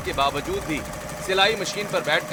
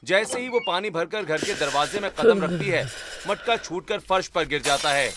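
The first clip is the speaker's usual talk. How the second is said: story narration. A narrator's reading. Narrator describing the sister's night on the roof, storytelling. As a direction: narrate the scene calmly.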